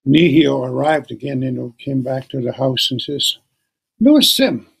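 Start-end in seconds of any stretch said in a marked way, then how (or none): 4.0-4.7 put-on young woman voice. Woman calls grandfather.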